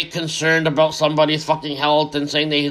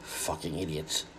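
much lower and much softer, slow, under the breath; muttered insult; 12 semitones lower, 16 dB quieter, slower than usual. Real, not acted. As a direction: mutter it to yourself in disgust. Muttered aside after a rant.

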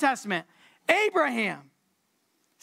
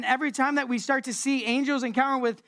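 ordinary talk

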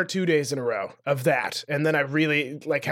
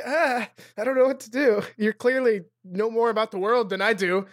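a hint of flustered panic, higher; mock-panicked before a teenager; flustered self; 6 semitones higher than usual. Imitating self panicking at nineteen-year-olds. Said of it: "I'm just like".

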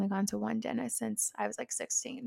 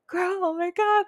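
higher, louder joyful squeal; excited relief; 10 semitones higher, 5 dB louder than usual. Retold or imitated. Anxious patient greeting her favorite dental assistant with joy. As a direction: burst out in happy relief.

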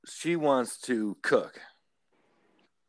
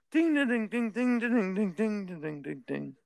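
imitated dishwasher chime; 9 semitones higher than usual. Telling host how his dishwasher chimes, mock sing-song ding. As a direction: mimic the done chime, starting high and dropping. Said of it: "it says".

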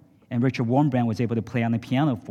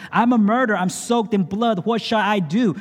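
hammed-up sobbing confession; 10 semitones higher, 4 dB louder than usual. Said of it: "sobbed noisily".

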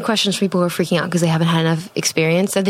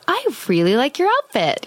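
raised pitch compliment, light and airy; higher voice; 6 semitones higher than usual. Retold or imitated. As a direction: lift it into a higher range. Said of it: "do something in a higher range".

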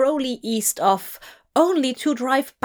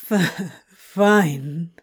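stuttered, grudging give-in; 4 semitones lower, 3 dB louder than usual. Acted. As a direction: relent with an eye roll. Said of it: "He relented with a hearty eye roll".

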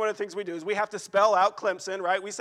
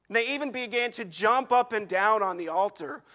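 normal talking voice

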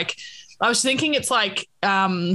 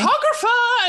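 calling the cartographer; 13 semitones higher than usual. Pretend. A podcaster summons an imaginary mapmaker, mock grand call. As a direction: sing out for the cartographer, playfully theatrical.

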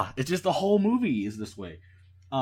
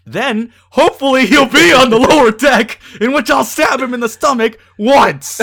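builds from plain to much louder and higher; mock scheming plan; 4 semitones higher, 10 dB louder than usual. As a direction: rattle off a crazy plan, rising to a shout. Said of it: "And you thought".

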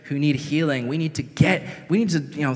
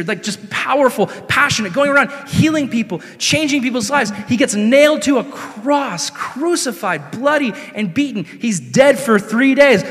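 higher-pitched, fired-up sermon delivery; impassioned preaching; 5 semitones higher, 3 dB louder than usual. Acted. Preacher rousing the church with its storm history, impassioned. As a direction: preach it with mounting fervor.

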